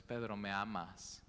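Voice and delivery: gently and slowly ask Pedro; Jesus asking a third time, softly and slowly; soft, slow tender question. Acted.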